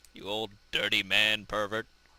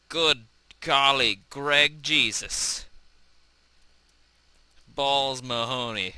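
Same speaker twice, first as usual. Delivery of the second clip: 1 mock dramatic exclamations; 3 semitones higher, 4 dB louder, slower than usual. Comic exclamations after crash.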